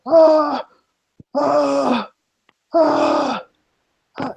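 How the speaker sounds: high muffled screams from within. Acted. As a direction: scream as if trapped inside stone. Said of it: "it sounds like a muffled scream".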